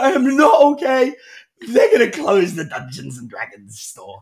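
mock wail, high and loud, then sinking; hammed-up tearful kid; crying child. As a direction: imitate a boy sobbing out bad news. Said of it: "he's crying".

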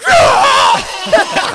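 very high, loud delighted scream; excited shriek. Retold or imitated. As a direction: let out a wild shriek of joy. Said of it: "freaked out".

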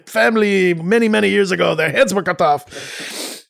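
high, exaggerated French accent; mock-aristocratic French accent, pitched high. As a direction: ham it up as French royalty with a thick French accent. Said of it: "French accent like this".

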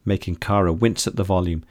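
plain reading voice

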